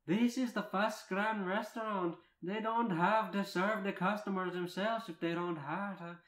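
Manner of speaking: high, loud, scolding character voice; Merida. Acted. Merida scolding Rita in story.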